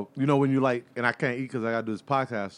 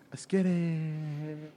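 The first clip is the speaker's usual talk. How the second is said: slow drawn-out hype intro; deep announcer voice; 5 semitones higher, 5 dB quieter, much slower than usual. Acted. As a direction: stretch it like a radio host. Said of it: "Why your voice just changed like that".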